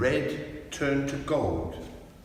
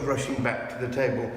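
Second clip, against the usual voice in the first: plain reading voice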